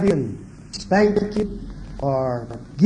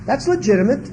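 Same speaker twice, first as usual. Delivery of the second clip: ordinary talk, nothing marked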